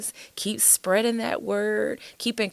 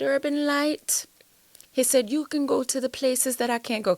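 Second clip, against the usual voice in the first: put-on pastor's Trinidadian accent, lightly done; 6 semitones higher, 3 dB louder than usual. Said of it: "that's my Trinidad, um, accent".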